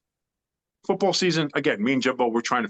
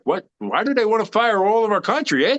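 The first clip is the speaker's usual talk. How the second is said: mock French Canadian accent, pitched up with a tossed-in eh; lightly hammed-up French Canadian player, baffled; 9 semitones higher than usual. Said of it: "I made them super French Canadian".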